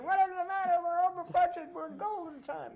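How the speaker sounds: high-pitched frantic crew voice; hammed-up high, panicky protest; mock panic. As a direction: imitate producers wailing over the budget. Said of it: "sweating and crying".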